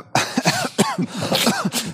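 sudden coughing fit after swallowing wrong; choking cough. Real, not acted. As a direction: cough hard as a drink goes down wrong. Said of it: "ich habe mich gerade verschluckt".

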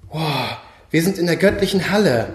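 ordinary delivery

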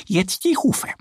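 high, brisk duck instruction; moving on to the next task; little duck voice. A narrator's reading. Annie telling Mo to clean the hooves, high duck voice. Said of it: "sagt Annie".

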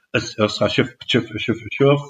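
Fischers Fritz reversed into „Schiff, Schiff“; backwards tongue twister. Shown as a demonstration. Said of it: "Das ist dann rückwärts".

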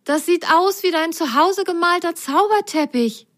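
put-on high, amazed little girl voice; Rosenrot voice. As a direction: marvel at it in a high voice. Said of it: "sagte sie zu ihm".

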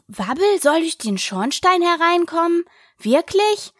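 wondering to herself, pitch leaping up; astonished question. A narrator's reading. Alice reacts to the plan to send Wabbel in, astonished.

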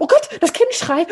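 high, loud panicky fuss; hysterical grandmother. Retold or imitated. Grandmother frets over the news, high and frantic. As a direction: flap about the awful news. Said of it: "so eine Hysterikerin".

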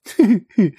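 a quick high cheeky giggle; loud cheeky mouse giggle. A narrator's reading. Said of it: "kicherte Frederik frech".

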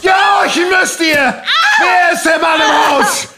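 shouted fanatic tirade; raging rant. Acted. Karl raves at his wife, shouting in a frenzy. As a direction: rave at her like a madman.

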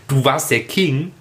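ordinary delivery

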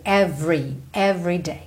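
low "Every" said as "ev-ree"; silent middle E. Shown as a demonstration. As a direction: run the V into the R in "Every". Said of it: "Pensa V R". ("Every" com V e R colados)

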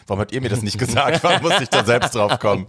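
retold protest building toward a laugh; mock indignation. Friend's mother asking why nobody told her, mock indignant complaint.

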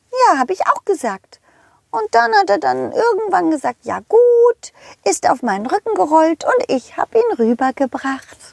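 squeaky high cartoon horse calling out. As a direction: play a cheerful high-pitched pony. Rosa the horse speaks. (hohe quietschige Pferdestimme)

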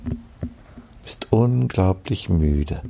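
slow mock hypnotist. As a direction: lull like a stage hypnotist. Mock hypnotist lulling listeners.

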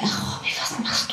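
softly whispering a worried plea; retold whisper. Wife whispers worried plea to her husband, soft whisper. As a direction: hush it as if pleading with him. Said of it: "da hab ich dann auch gesagt".

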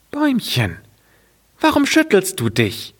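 put-on very high little girl voice; high child voice asking. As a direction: ask like a small girl with a jug. Girl asks the tree.

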